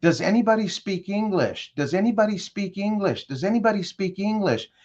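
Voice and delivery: high, frantic call for help; panicked shouting. Retold or imitated. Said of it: "you start screaming".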